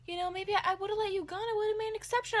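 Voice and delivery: high, sugary apology; apologetic proctor. Retold or imitated. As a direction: play the proctor saying sorry on the phone. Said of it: "she's like".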